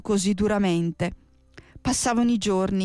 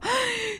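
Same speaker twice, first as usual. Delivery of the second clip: startled gasp. A narrator's reading. Children spot the witch.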